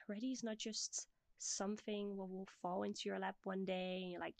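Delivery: normal talking voice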